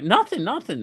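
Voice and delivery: high, breezy nothing nothing; mock wife deflecting. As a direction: play the wife brushing it off. Voicing wife's evasive reply.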